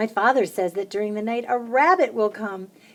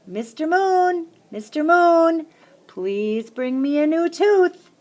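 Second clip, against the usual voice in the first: high, slow, loud sing-song call; calling to Mister Moon; 9 semitones higher, 6 dB louder, slower than usual. A narrator's reading. Child on the roof calling to the moon, slow and high. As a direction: call up to the sky, pleading slowly.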